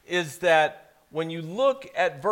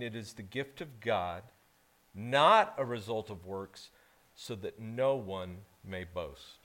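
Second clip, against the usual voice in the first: scripture reading; 4 semitones lower, 7 dB quieter than usual. Acted. Pastor reading scripture to the congregation, solemn. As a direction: read the verse with reverence.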